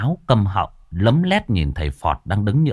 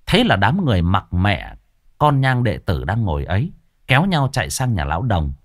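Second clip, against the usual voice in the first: ordinary delivery, nothing marked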